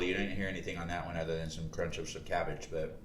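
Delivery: ordinary talk, nothing marked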